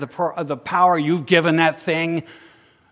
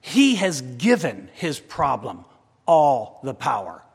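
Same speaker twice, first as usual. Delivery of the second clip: He has given his problem all the power, slow and deliberate; slow corrected restatement; 4 semitones lower, slower than usual. Shown as a demonstration. Preacher restating his point to the congregation, slow and deliberate. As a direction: lay out "given" slowly, word by word.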